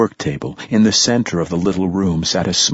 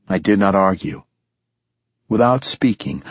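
ordinary delivery

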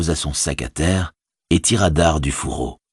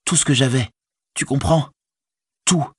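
higher-pitched, stricken hobbit; despairing Frodo; 9 semitones higher than usual. A narrator's reading. Robbed hobbit confiding in his servant, higher and distressed. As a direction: lament it in a higher voice.

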